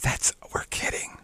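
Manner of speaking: whispered aside; breathy stage whisper, just kidding. Real, not acted.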